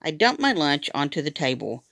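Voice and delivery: ordinary delivery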